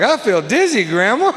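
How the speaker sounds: 어지러운 척하는 아이처럼 높고 가는 목소리로; high childish voice. Retold or imitated. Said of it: "Then I was like".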